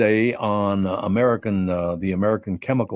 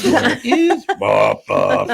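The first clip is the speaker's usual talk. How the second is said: much higher, slow squeak; high squeaky voice; 14 semitones higher, 4 dB louder, much slower than usual. Retold or imitated. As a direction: echo it in a tiny high voice. Echoes in high voice.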